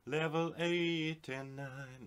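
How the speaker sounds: high, slow, loud announcement; dramatic announcer. Acted. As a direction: boom it out like an announcer.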